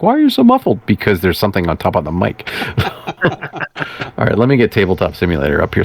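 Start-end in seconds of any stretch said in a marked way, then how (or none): 0.0-0.8 much higher, puzzled imitation; high mock question. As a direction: mimic a baffled listener, pitched up. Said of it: "you're like".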